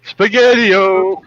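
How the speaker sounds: high, slow goofy shout of Spaghetti-o; silly high drawn-out exclamation. Acted. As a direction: stretch it out high and loud, playfully.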